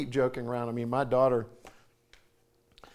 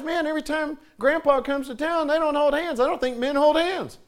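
put-on higher, boyish musing voice; young boys thinking aloud; 10 semitones higher, 4 dB louder than usual. Little boy noticing grandpa and dad, boyish musing. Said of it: "my boys started to realize".

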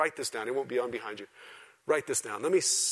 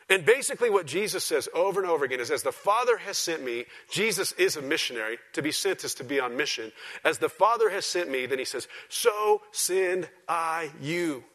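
loud, high preaching voice; full-force preaching; 6 semitones higher, 6 dB louder than usual. Real, not acted. Preacher explaining Scripture to his church, preaching at full force. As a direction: drive the point home, raised and forceful.

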